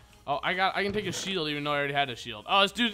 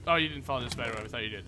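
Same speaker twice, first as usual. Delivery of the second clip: usual speaking voice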